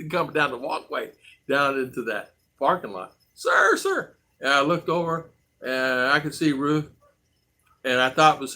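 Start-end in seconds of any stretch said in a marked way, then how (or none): 3.3-4.2 high falsetto sir, sir; imitated woman calling. Women in the parking lot calling after him, high falsetto.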